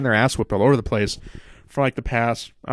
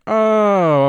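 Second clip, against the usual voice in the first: high, louder "oh" of getting it; light up as it clicks; sudden realization; 9 semitones higher, 5 dB louder than usual. Real, not acted. Realizing the Mountie reference.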